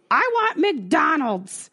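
put-on high, slow whiny drunk demand; drunk craving. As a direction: drag it out like a drunk girl begging. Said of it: "And Nicole goes".

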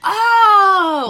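a sharp gasp, then a high, loud "oh"; gasp of recognition. Real, not acted. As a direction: gasp as it suddenly clicks. Suddenly recognizes Uncle Josh.

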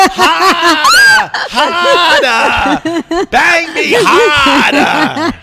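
put-on high, shouted, drawn-out Boston accent; mock ecstatic wail, broad Boston vowels. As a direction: holler it like a hammed-up Boston girl. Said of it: "the accent drove me nuts".

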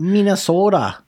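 high-pitched mock Minnesota accent. Shown as a demonstration. Naming another bad accent to his co-host, mock Minnesota accent. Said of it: "Minnesota. That's a bad accent".

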